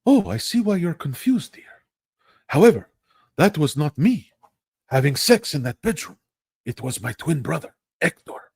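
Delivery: a hammed-up telenovela charmer talking his way out; telenovela husband. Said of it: "career in telenovelas".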